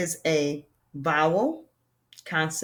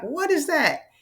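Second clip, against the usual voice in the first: higher-pitched puzzled question; baffled pupil; 9 semitones higher than usual. Acted. Teacher acts out pupils hearing new terms, baffled. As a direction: voice a confused learner, pitch swooping up. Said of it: "And you're like".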